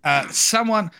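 put-on playful Australian accent, reading a friend's joke aloud; mock Australian accent. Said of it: "Pretend to be Ali".

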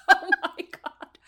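real high-pitched squeal of laughter; laughing hard. Real, not acted. Co-host reacting to friend's walkout story, laughing hard. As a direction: squeak it out slowly through loud laughter.

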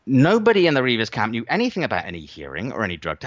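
normal talking voice

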